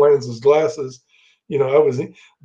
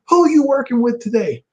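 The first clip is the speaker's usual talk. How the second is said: high, strained mock yell; hammed-up furious roar at the culprit; imitating Sid yelling at Bobby; 9 semitones higher than usual. Sid threatening, retold.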